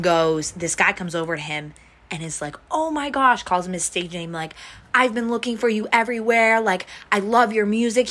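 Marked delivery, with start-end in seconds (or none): 2.7-3.4 high, excited fan squeal; gushing fan. Retold or imitated. Fan spots the rapper, gushing. 4.9-8.1 higher, eager fan voice; starstruck fan. Retold or imitated. Fan gushing to the rapper.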